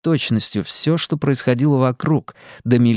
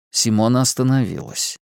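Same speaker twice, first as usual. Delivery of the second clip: ordinary delivery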